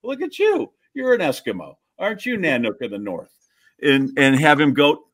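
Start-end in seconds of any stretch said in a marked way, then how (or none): 0.0-3.3 mock cooing adult. Imagined payback on a trick-or-treater, mock cooing. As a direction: pretend fussing over a kid's costume. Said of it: "have some kid and just go".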